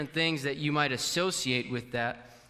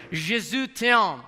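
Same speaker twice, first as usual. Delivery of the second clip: slow, louder, higher French demo; French phrase; 7 semitones higher, 7 dB louder, slower than usual. Shown as a demonstration. Preacher demonstrating French to the congregation, slow and bold. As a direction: speak it in French, slowly and proudly. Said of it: "I would start speaking in French".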